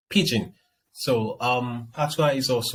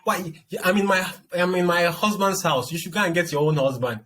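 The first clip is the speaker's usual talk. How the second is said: mimicking nagging mom; 8 semitones higher, 3 dB louder than usual.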